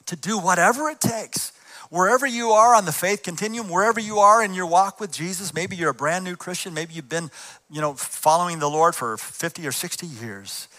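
rally the church; preacher's rising exhortation; rousing challenge. Acted. Challenge to the congregation.

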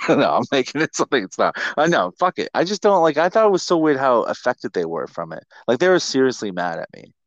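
normal talking voice

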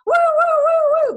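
high, loud woo woo of a passing siren; siren imitation. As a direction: mimic a police siren wailing by your door. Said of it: "goes screaming past my door".

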